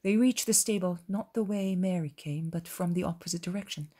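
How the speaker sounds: usual speaking voice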